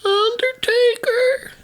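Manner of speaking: very high, slow quavering announcement; Paul Bearer voice. Retold or imitated. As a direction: stretch it out eerie and high. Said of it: "I can't do my, my voice".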